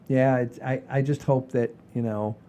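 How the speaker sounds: ordinary delivery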